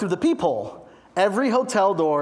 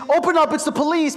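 police shout; 7 semitones higher, 3 dB louder, faster than usual. Retold or imitated. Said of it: "And all I hear is".

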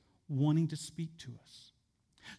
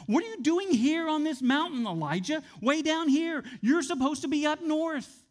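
higher and louder, pressing questions; God's insistent challenge; 6 semitones higher, 4 dB louder than usual. Pretend. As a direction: demand it like God rebuking a stray prophet. God questioning Elijah, emphatic.

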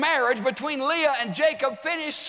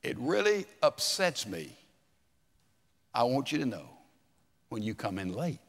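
softer, lower, calm honest words; gentle tone; 10 semitones lower, 6 dB quieter than usual. Retold or imitated. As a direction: tell him kindly how it hurts you. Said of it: "tone of voice, inflection".

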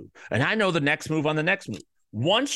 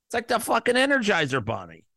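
ordinary talk

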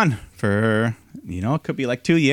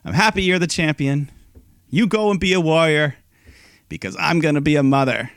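tender retold announcement; 6 semitones higher than usual. Host voicing Becky revealing her pregnancy, tender and proud. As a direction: tell her proudly and warmly. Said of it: "Becky's like".

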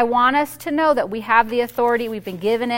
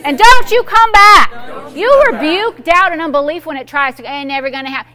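loud preacher's rebuke; preaching at full force; 6 semitones higher, 8 dB louder than usual. Acted. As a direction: command the doubt away with fire.

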